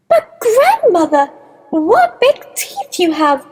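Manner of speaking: put-on high little-girl voice with a touch of nerves; slightly quivering. A narrator's reading. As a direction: pitch it up, faintly uneasy. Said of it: "her voice quivering slightly".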